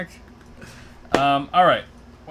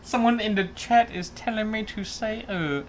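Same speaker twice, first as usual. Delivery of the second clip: put-on Vietnamese accent; 5 dB quieter than usual. Co-host doing a punishment for the show, Vietnamese accent. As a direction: speak with an exaggerated Vietnamese accent. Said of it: "I will do a Vietnamese accent".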